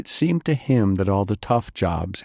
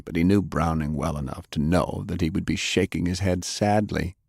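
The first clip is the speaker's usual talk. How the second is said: usual speaking voice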